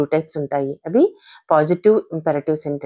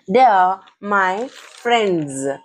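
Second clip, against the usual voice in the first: "They are... my... friends", very slow and spaced; slow pronunciation model; much slower than usual. Shown as a demonstration. Teacher modelling the sentence for learners, slow and deliberate. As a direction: draw out "friends" to a voiced z ending. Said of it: "friends అని పలకాలి".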